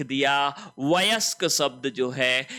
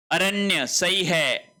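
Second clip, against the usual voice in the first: ordinary talk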